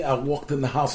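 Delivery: usual speaking voice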